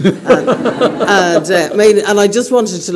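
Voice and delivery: real giggle spilling into the words; laughing while talking. Real, not acted. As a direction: laugh through an embarrassing memory. Laughing at her own embarrassing story.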